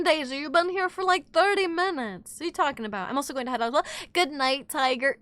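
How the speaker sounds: normal talking voice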